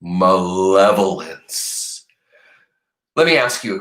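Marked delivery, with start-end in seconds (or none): 0.0-2.0 malevolence slow and even, each syllable spoken; modelled word. Shown as a demonstration. Teacher repeating a new word, slow and clear. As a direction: say malevolence slowly again for kids. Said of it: "Say that word".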